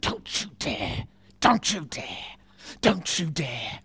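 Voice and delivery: a hint of a mother's hushed warning in church; warning mum. Mum silently warning her son in church. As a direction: mouth the threat across the pew. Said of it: "Mum would be like this".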